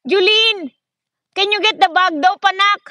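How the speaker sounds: loud high call across the house. Real, not acted. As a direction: shout for Julene to hear from afar. Calling someone across the house.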